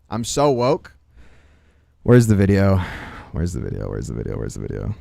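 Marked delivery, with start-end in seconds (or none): 3.3-4.9 much lower and softer, muttered to self; muttered impatient repeat; low mumble. Real, not acted. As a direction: mumble it down low under the breath.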